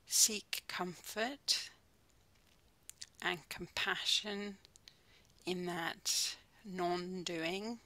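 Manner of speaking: calm, unhurried mindfulness guide with long pauses; soothing body-scan voice, phrase by phrase; guided meditation. Real, not acted. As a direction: ease them gently into stillness, leaving space between phrases.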